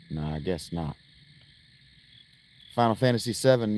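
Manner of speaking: usual speaking voice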